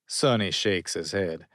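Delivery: ordinary delivery, nothing marked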